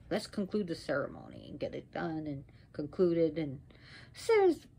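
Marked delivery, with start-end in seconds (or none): none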